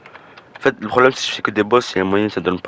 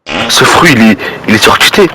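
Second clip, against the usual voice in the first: much louder, blurting it out; excited outburst; 15 dB louder than usual. Real, not acted. Gamer raving to viewers about the fruit, loud excited outburst. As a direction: shout how broken the fruit is.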